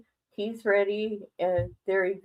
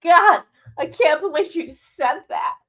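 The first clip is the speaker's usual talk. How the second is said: shocked onlookers; 8 semitones higher, 8 dB louder than usual. Retold or imitated. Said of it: "They're like".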